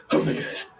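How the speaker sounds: gasped, then high-pitched freak-out; half-laughing, hyperventilating disbelief. Retold or imitated. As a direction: build from a breathless gasp to a high squeal. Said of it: "I start, like, freaking out".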